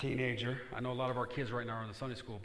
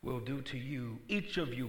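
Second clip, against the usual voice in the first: ordinary talk, nothing marked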